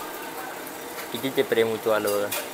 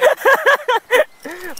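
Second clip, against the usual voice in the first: shrieking laugh, much higher; high burst of laughter; 5 dB louder than usual. Real, not acted. Vlogger laughing at his friend's remark, high squeal. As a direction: crack up in a squeal.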